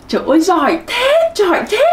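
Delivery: high, gushing teacher praise; delighted praise. Pretend. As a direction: marvel at how clever they are. Praising imagined pupils after practice.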